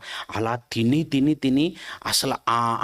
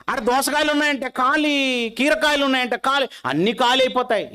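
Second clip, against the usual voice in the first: preaching at full force; 12 semitones higher than usual. Acted. Preacher picturing the crowd for his listeners, ringing and raised. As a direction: proclaim it to the whole congregation. Preacher drives home empty fields.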